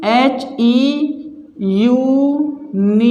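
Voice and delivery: drawn-out letters then the word; slow spelling out. Shown as a demonstration. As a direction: spell it slowly so students follow. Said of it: "ध्यान से देखना".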